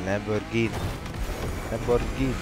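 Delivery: attempted Italian accent on the car name; Italian accent, rolled R. Shown as a demonstration. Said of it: "I like to roll the R's too".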